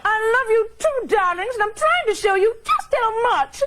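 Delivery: hammed-up [name] impression. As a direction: overblown diva, tearful and breathy-high. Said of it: "I do one terrible impression".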